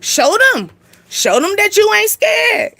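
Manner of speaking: high, rising taunt that climbs and slows to a cracking cry; mischievous bad angel urging a fight; bad angel egging on. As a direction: hype them up like a little devil on your shoulder. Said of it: "And then bad angel, like".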